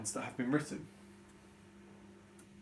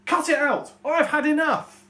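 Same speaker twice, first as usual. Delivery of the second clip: much louder, much higher yell; furious shout; 14 semitones higher, 12 dB louder than usual. Shown as a demonstration. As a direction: explode at the bickering kids. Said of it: "change my voice".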